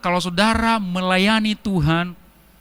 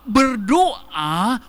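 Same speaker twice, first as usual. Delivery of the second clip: ordinary talk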